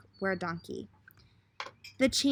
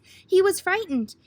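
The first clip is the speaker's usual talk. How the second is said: higher and louder, alarmed; frightened; 7 semitones higher, 7 dB louder than usual. A narrator's reading. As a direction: sound startled by the lion. Lion appears in story.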